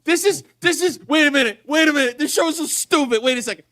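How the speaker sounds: incredulous. Real, not acted. Disbelief at the plot.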